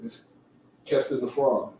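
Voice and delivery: ordinary delivery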